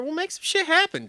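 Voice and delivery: high-pitched eager pitch; hammed-up eager. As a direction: oversell it like a hungry rider. Mock eager pitch to sponsor.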